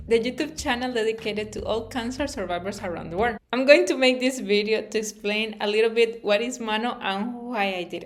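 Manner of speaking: ordinary talk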